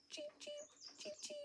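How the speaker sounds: very high, soft peeping; soft high chick cheeps for a child; baby chicks. Pretend. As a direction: peep like tiny chicks. Baby birds voiced.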